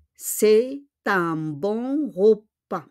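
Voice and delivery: "c'est un bon repas" very slow, each word spaced; slow model sentence. Shown as a demonstration. Teacher modelling the sentence slowly for students. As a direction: spell it out slowly for learners.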